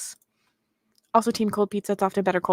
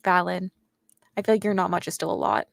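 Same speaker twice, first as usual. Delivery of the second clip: ordinary delivery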